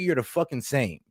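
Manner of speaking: ordinary talk, nothing marked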